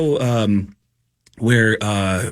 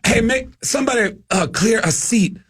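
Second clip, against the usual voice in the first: Mitch Hedberg; 7 semitones higher than usual. Retold or imitated. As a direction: sing out each phrase high, hunting for words. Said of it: "And he goes".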